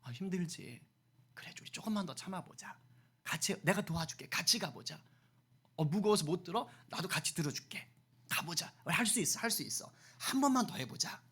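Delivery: soft, tender coaxing; gentle encouragement. Acted. Preacher modelling help for a struggling person, gentle encouragement. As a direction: coax them along softly, side by side. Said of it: "이렇게 하라고요".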